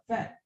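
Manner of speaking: "fat" as one short, soft, high beat; one syllable. Shown as a demonstration. Teacher giving another word like tall, one syllable. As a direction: say "fat" in a single sound. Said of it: "One syllable".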